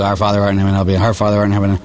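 low, hurried gabble of a prayer; rattled-off prayer. Retold or imitated. Penitent rushing through ten Our Fathers as penance, rattled off. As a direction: race through the prayer by rote, running words together. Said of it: "say these prayers really, really fast".

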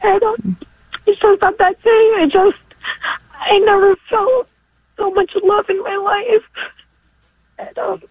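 high, tearful, voice cracking with gratitude; fighting tears to finish the thought; real crying, overwhelmed with thanks. Real, not acted. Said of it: "I wasn't expecting to get so emotional".

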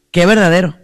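ordinary talk

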